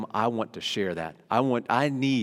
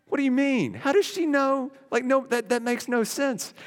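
much higher, incredulous questions; baffled disbelief; 13 semitones higher than usual. Retold or imitated. Preacher retelling his reaction to a friend, high and incredulous. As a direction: react as if the claim makes no sense. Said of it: "I remember being like".

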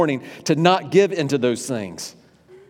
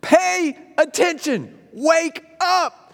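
high, slow drill sergeant shout; 5 dB louder, slower than usual. Retold or imitated. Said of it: "in this military voice, like a drill sergeant".

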